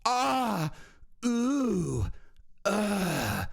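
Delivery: light mocking moan sounds. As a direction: ham up her fight-night oohs. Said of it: "she screams".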